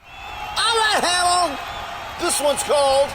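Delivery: high, shouted rock intro; hyped announcer. Acted. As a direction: hype up the song like a DJ.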